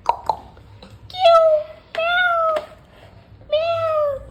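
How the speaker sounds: very high, far-off meowing; squeaky high kitty voice; cat meow. Retold or imitated. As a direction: meow like a kitten. Cat noises.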